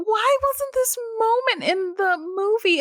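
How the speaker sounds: high, plaintive fan wail; dismayed high-pitched complaint; mock indignant plea. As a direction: protest it high, as a wounded fan. Acting out indignant fan reaction.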